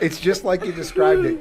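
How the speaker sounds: giggling while talking; words riding on a laugh. Real, not acted. Still laughing at the video.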